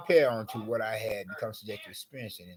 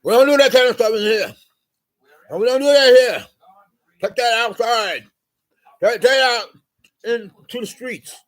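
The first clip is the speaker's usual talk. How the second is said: much higher, much louder furious yell; retold yelling; 13 semitones higher, 20 dB louder than usual. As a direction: scream at the customer like you mean it. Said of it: "mouthing off".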